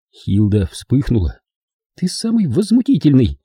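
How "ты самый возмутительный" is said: high, indignant woman's voice; outraged exclamation. A narrator's reading. Hilda flares up at her vulgar guest, high outraged exclamation. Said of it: "воскликнула она".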